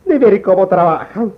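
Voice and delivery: coqueto y teatral; voz amanerada de estilista. Acted. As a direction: estilista chismoso que llega curioseando. Estilista afeminado, voz aguda. (voz amanerada)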